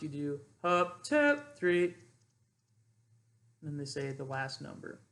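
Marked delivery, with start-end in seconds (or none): 1.0-1.8 "two, three" jumped way up high and loud; high, loud spike on "two, three"; four-count inflection. Shown as a demonstration. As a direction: snap "two, three" up sharply to cue the last number. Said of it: "when you're doing four counts".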